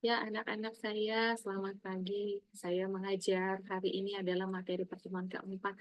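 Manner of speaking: soft, monotone dull lecturer; demonstrating a boring, lifeless class opening; flat delivery. Shown as a demonstration. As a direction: drone it quietly with no intonation. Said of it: "Flat aja gitu".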